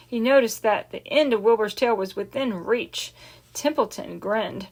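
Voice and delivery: ordinary delivery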